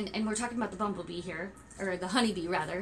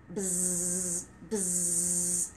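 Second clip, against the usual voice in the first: low buzzing bee sound; 5 semitones lower, 4 dB quieter than usual. Shown as a demonstration. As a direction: buzz like a little bee. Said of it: "Can you make a sound like a bee?".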